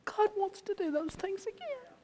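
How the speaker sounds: high, fervent preaching. Acted. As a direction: lift it up to a climax. Preaching climax.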